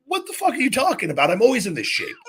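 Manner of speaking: very high, incredulous mock protest with a laugh; retold indignant disbelief. Wrestler told the boss never noticed his physique, comically indignant. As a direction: pitch jumps way up, protesting in exaggerated disbelief.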